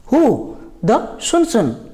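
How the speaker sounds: ordinary delivery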